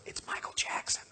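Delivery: hushed, awed whisper; acting out a stunned whisper; retold whisper. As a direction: whisper it like a secret across the table. Mimes Kenny passing on the secret.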